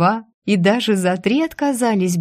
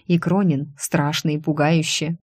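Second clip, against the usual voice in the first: ordinary delivery, nothing marked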